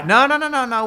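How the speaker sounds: urgent mock objection; hasty interruption. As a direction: cut in quickly, acting out a refusal. Messengers cut Balaam off.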